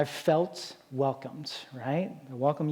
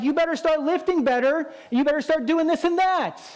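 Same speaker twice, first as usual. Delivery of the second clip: high, louder barked orders; mock yelling; 10 semitones higher, 3 dB louder than usual. Gym critic yelling at a struggling lifter, hammed-up shouting. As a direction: yell commands at the lifter. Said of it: "they're just yelling at you".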